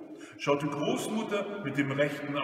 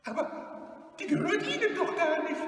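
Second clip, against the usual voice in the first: put-on very high, quick old-lady voice; fearful little objection; timid grandmother; much faster than usual. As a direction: protest anxiously, high-pitched. Frightened grandmother objects to robber.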